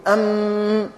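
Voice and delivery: "أم" with a long humming m; ghunna on mim. Shown as a demonstration. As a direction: hold the m of "أم" through the nose. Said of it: "ويكون في الميم".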